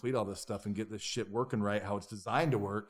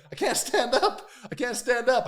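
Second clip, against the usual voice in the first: higher, louder, laughing through it; laughing dazed plea; 12 semitones higher, 7 dB louder than usual. Retold or imitated. Concussed man laughing as he tells friends he can't stand. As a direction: play the concussed guy who can't stand.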